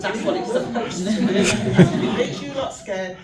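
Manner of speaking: loud, laughing comeback; laughing retort. Retold or imitated. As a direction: laugh it in his face.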